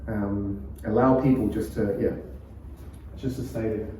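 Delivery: normal talking voice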